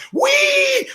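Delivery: high, stretched squeal; long high-pitched whoop; roller-coaster whee. Retold or imitated. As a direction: squeal it like riding downhill. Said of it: "you know that I'm wee".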